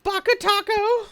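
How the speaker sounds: put-on high, squeaky anime-girl "Baka Taco!" in a Japanese accent; Japanese-accented anime squeal. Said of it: "in the Japanese accent".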